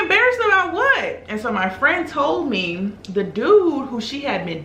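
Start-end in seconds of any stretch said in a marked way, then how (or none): 0.0-1.2 high-pitched disbelief, acted out; mock incredulous. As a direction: retort in an exaggerated high squeal of disbelief. Acting out her disbelieving reply.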